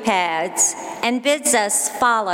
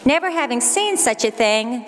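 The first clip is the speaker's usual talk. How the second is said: normal talking voice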